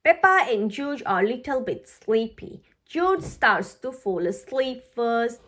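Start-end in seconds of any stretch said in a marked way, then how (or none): none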